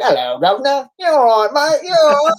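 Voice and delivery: high, loud, hammed-up British accent; terrible fake UK accent. Shown as a demonstration. Actor showing his worst fake UK accent, hammed-up British. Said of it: "U K accent is the worst".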